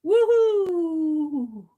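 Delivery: a loud, gleeful 'Woohoo!' from a storybook bug; cheery caterpillar woohoo. Acted. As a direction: read it like a delighted little caterpillar. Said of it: "the caterpillar says".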